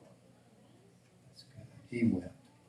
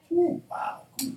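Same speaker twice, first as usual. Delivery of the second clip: high, loud mock whoop; hammed-up impressed cheer; mock excitement; 8 dB louder, slower than usual. Mocks admiration of influencers.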